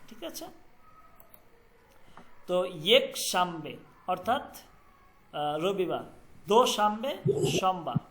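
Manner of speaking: ordinary delivery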